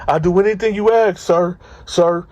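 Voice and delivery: a frightened new inmate eager to obey; scared inmate. Retold or imitated. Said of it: "He said".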